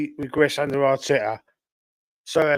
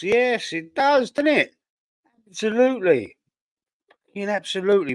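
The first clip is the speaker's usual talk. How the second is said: excited agreement; 6 semitones higher than usual. Real, not acted. Eager agreement with chat.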